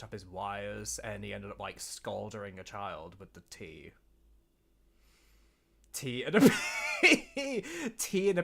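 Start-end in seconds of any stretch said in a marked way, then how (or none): 5.9-8.4 higher, louder and slow, laughing through it; laughing out loud at the absurd idea. Real, not acted. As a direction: crack up mid-sentence. Laughs at own old story.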